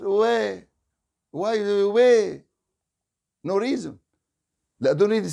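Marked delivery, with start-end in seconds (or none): none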